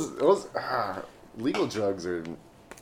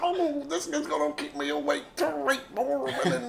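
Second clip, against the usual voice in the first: Cosby impression; 4 dB louder than usual. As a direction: mumble like a wired old comic. Mock Cosby on cocaine.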